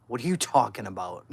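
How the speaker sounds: loud, incredulous retort; retold indignant retort. Daughter arguing back at drunk dad, indignant. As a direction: push back loudly, half laughing. Retold argument with her father.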